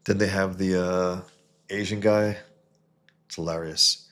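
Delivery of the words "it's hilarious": usual speaking voice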